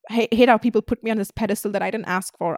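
normal talking voice

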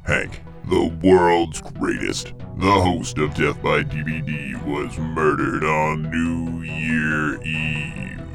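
ordinary talk

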